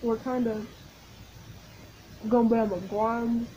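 speaking between bites; talking while chewing. Real, not acted. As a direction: mouth full of snack. Seen 2: bites a ring snack at 1.1s, then chews while talking.

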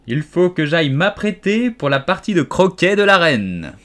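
high, loud, self-important announcement; bustling duchess. A narrator's reading. Duchess leaving for the queen's croquet party, bustling. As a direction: proclaim it grandly on the way out.

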